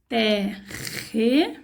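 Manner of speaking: "Terre" with a drawn-out strong trilled rr; stretching the syllable for first graders; strong R sound. Shown as a demonstration. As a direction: sound out "Terre" and stress the double R. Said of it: "Olha aqui o som do R forte".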